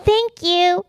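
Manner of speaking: high polite thank you; sugary phone thanks. Pretend. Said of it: "voice gets so fake on the phone".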